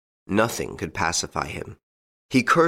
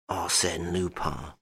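much softer, slow, stunned murmur of the name Arsène Lupin; 2 semitones lower, 8 dB quieter, slower than usual. A narrator's reading. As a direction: let Arsène Lupin sink in, aghast. Duke stunned, face livid.

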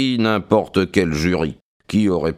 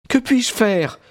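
higher, lightly voiced young woman; young woman's voice; 13 semitones higher than usual. A narrator's reading. Maud speaking to Stackhurst, composed young woman's voice.

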